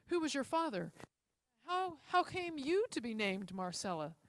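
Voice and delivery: put-on excited old man questioning; excited old gentleman. A narrator's reading. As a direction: press for answers, a little excited. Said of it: "an excited old gentleman".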